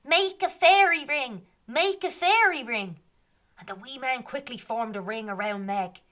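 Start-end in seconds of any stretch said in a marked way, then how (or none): 0.0-3.0 higher, louder, slow commanding call; fairy shouting orders. Acted. Fairy leader ordering the ring, loud and high. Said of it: "one of them shouts".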